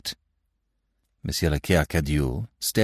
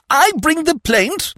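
high, slow, shocked echo; horrified disbelief; 5 dB louder than usual. A narrator's reading. Godfather aghast at being asked to sue. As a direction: recoil at the idea. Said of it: "wide with horror".

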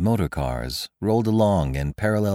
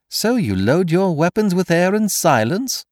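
high-pitched, incredulous taunt; mocking; 11 semitones higher, 4 dB louder than usual. A narrator's reading. Stranger jeering at Kesselbach's silent revolver, mocking. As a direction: ridicule his useless weapon. Stranger mocks the misfired gun.

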